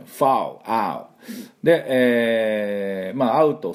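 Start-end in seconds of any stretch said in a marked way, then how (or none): 0.0-1.0 foul, then the ow vowel stretched alone. Shown as a demonstration. Teacher drilling foul for the class, ow vowel. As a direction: model foul and its ow sound. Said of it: "fallingでありません".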